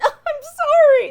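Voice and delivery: high drawn-out sorry. Shown as a demonstration. Acting out a reply to show how tone shapes it, high stretched sorry. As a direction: stretch it out high and loud like a shocked apology. Demo of responding line.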